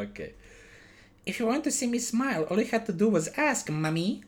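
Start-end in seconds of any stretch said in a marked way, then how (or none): 1.3-4.2 put-on creepy child voice. Creepy child voice in improvised story.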